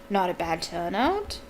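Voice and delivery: ordinary delivery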